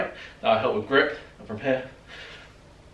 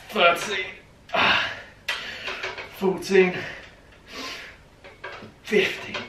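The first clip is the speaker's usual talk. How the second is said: high effortful count; strained counting; 6 semitones higher, slower than usual. Real, not acted. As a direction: count through the burn. Counting final reps under strain.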